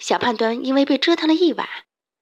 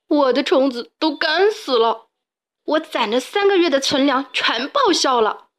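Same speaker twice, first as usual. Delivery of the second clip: dejected lament; 4 semitones higher than usual. A narrator's reading. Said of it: "沮丧地捧着一大把虫子".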